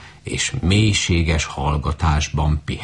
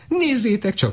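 high, indignant goose squawk; lightly voiced indignant goose. A narrator's reading. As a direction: squawk at the flock in protest. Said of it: "méltatlankodva gágogott".

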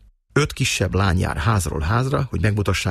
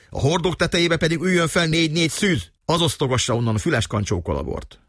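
raised-pitch, lordly instruction; peremptory command; 7 semitones higher than usual. A narrator's reading. Márton giving orders about the wine barrels, proud and commanding. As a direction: hand out orders with mild self-importance. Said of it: "abba a peremtóriós parancsoló hangba".

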